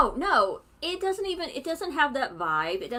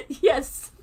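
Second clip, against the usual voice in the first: ordinary talk, nothing marked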